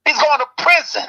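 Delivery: usual speaking voice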